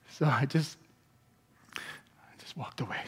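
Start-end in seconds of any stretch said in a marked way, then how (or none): 1.8-3.0 unvoiced, hushed confession; whispered. Real, not acted. Pastor whispering to the congregation that he walked away. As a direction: whisper it like a sheepish aside. Retelling quietly walking off.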